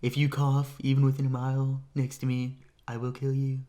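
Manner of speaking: put-on comic presidential impression; Alec Baldwin's Donald Trump. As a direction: do a mock politician's pronouncement, pitch held level. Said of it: "my Alec Baldwin impression of Donald Trump".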